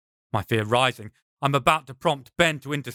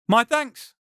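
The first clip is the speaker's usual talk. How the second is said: high, louder call of thanks; 10 semitones higher, 4 dB louder than usual. Acted. Paul thanks the rabbi from afar, high called thanks. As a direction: call it out to the stranger, much higher. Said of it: "I call out".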